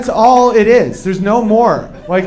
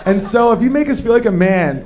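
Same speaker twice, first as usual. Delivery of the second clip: ordinary talk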